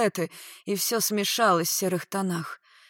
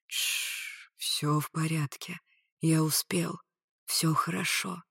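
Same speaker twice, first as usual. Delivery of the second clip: hushed, slow reassurance; shush, then comfort softly; soothing; 3 semitones lower, 5 dB quieter, slower than usual. A narrator's reading. Rescuer calming a sobbing woman, soothing.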